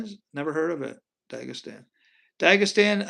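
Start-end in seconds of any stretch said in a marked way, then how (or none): 1.3-1.8 Dagestan said softer, low and trailing off; Dagestan, tried quietly; muttered to himself. Shown as a demonstration. Trying out an unfamiliar name. 2.4-3.1 Dagestan said louder and higher, like a proclamation; Dagestan, declared out loud; announced firmly. Shown as a demonstration. Trying out an unfamiliar name.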